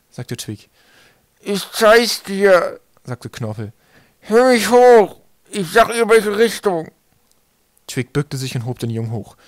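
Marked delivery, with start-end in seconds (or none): 1.4-2.8 very slow, high, muffled words; mouth-full voice. A narrator's reading. Swollen boy offers Twig directions, mouth-full voice. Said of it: "als ob Knorpel mit vollem Mund redete". 4.2-6.9 high, loud, stuffed-mouth speech; mouth-full voice. A narrator's reading. Swollen boy asks Twig to lift him, mouth-full voice. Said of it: "als ob Knorpel mit vollem Mund redete".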